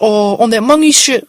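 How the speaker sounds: high, loud, drawn-out gang talk; hammed-up street guy asking about gangs; loud street stranger. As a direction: stretch it out slow, high and loud. Stranger talks gang stuff.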